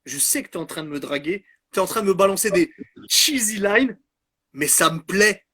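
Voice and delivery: playful flirt, building to excited urging. Retold or imitated. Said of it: "[name] est en train de dire".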